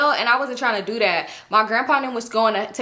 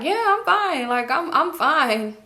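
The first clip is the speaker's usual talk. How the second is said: slow, teary "I'm fine" that cracks; voice breaking; 5 semitones higher, slower than usual. Retold or imitated. Janet insists she's fine to her friend, voice breaking. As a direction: fight back tears while insisting all is well. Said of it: "Voice breaking".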